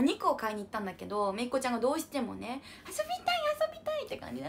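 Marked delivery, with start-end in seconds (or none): 2.9-4.0 put-on high little-girl plea to play; begging child. Niece begging her aunt to play, high pleading child voice. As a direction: pitch it up like a small child begging.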